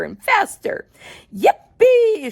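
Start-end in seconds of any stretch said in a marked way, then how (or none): 1.3-2.3 high, loud whoop of glee; joyful shout. Acted. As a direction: whoop like a kid on a ride. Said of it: "shouted Grandpa Joe again".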